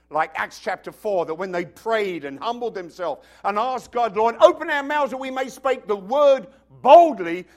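loud, driving sermon climax; full-force preaching. Acted. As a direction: preach it out to the congregation, building.

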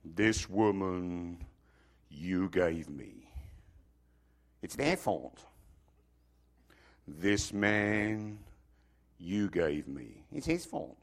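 low, slow, echoing whisper of blame. Shown as a demonstration. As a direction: let it echo deep in their heads. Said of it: "I want this voice to echo in your subconscious".

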